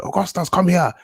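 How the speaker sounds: high, loud Nigerian mum summoning her son; Nigerian accent. Retold or imitated. Guest imitating his mum ordering him over, Nigerian accent. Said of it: "in a Nigerian accent".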